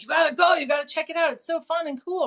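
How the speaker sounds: high, bubbly imitation of a mom pushing you; mock excited urging. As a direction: sell it like an overexcited sister. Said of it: "my mom and sister were like".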